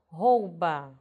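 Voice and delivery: "rouba" com OU fechado e forte; pronúncia correta. Shown as a demonstration. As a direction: corrija com "rouba", rôu-ba, mais alto. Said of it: "Não". ("rouba" com o U bem audível)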